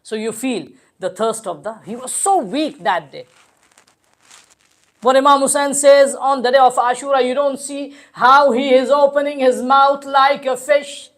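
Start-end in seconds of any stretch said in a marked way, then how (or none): 5.0-11.1 loud, sustained lamenting intonation; mournful recitation. Acted. Preacher recounts the infant's thirst to mourners, mournful recitation. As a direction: hold one tone and let it carry.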